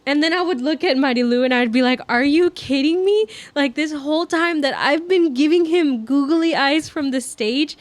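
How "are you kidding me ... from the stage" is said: higher, louder indignant rant; mock outrage. Rant at friend about the boy on stage, in high loud mock outrage. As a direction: vent like you've been betrayed.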